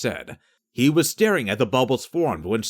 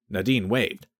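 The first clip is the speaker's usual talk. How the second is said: ordinary delivery, nothing marked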